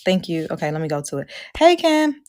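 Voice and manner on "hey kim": high, loud hello across the room; calling out a greeting. Real, not acted. Speaker greeting a friend in the audience, called out loud. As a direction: call out to her by name.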